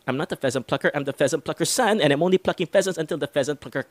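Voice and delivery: "and I'm only plucking pheasants" rushed through at top speed; fastest tongue twister. Shown as a demonstration. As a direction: race through it without tripping. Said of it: "go a little bit faster for this last time".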